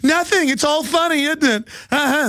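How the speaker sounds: high, sarcastic sing-song; high mocking sing-song. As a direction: sing it out in mock innocence, high. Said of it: "And I went".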